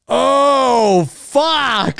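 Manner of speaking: high, drawn-out dismayed oh; dawning dread. Retold or imitated. Viewer seeing the body trail leave town, high dismayed groan. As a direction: let the realization sink in high. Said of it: "and it's like".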